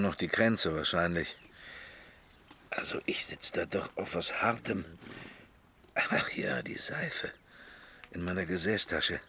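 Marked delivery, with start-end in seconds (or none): 2.7-7.3 hushed private whisper; secretive whisper, almost unvoiced. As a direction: whisper it to yourself in the carriage. Private thought in the carriage.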